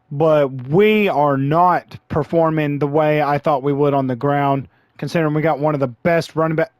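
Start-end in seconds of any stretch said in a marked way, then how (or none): none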